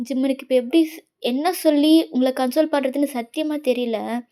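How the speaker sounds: usual speaking voice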